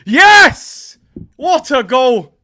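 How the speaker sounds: loud, high, jubilant exclamation; triumphant shout. Real, not acted. Streamer savouring the Wolves goal, triumphant shout. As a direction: punch it out in triumph.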